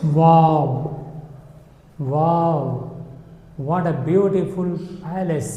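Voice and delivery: drawn-out, demonstrated wow of admiration; admiring wonder. Shown as a demonstration. Teacher showing learners a sudden good feeling, mildly awed. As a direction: marvel slowly, as an example. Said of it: "हमें अच्छा लगा".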